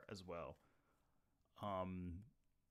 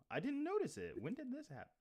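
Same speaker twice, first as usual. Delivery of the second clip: much higher, startled delight; high-pitched surprised questions. Real, not acted. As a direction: caught off guard by a surprise gift. Surprised by a new Super Chat.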